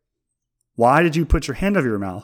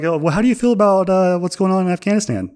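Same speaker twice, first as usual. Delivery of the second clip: mock adult question; 6 semitones higher, faster than usual. Adult quizzing a four-year-old about the news, mock earnest. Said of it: "And, uh, say, like".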